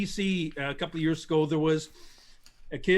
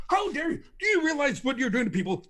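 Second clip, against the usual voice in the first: mock outraged pundit; 10 semitones higher, 3 dB louder than usual. Mocking entertainer interrupting scientist.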